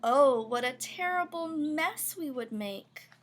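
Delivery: ordinary delivery, nothing marked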